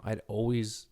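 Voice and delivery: normal talking voice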